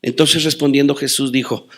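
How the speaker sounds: ordinary delivery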